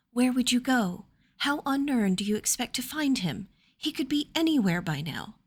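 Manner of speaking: a hint of a lady's anxious protest; lady doubting her friend's rash plan; alarmed lady. Amelia objects.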